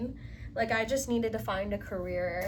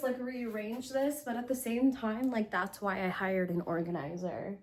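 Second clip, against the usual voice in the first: ordinary talk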